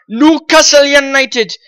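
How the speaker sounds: drawn-out Geordie accent, lifted pitch. Shown as a demonstration. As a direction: say it slowly in a playful Geordie accent. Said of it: "in a very nice Geordie accent".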